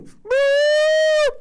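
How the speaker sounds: high, loud cartoon "boop" sound effect; mock boop sound. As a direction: pop it out like a silly sound cue. Mock sound effect after statement.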